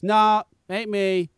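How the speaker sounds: slow, drawn-out mock denial; feigned innocence. Mechanic denies being the man who was paid, feigning innocence. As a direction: imitate someone lamely playing dumb. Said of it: "pretending like it wasn't him".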